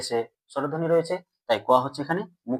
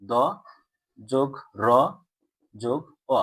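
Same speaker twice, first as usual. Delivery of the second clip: "দ যোগ র যোগ অ" piece by piece, with pauses; broken into sounds; 2 semitones lower than usual. Shown as a demonstration. Teacher breaking down দ্র for pupils, "দ যোগ র যোগ অ" in separate pieces. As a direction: spell out "দ যোগ র যোগ অ" slowly, sound by sound. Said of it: "দ্রটা যদি আমরা ভাঙি".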